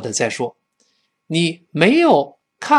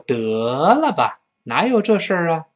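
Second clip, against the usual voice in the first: scoffing disbelief; 4 semitones higher than usual. Acted. Answering an outlandish claim, scoffing disbelief. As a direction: stretch the first word, waving it away. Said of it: "对方说了一个非常离奇的话".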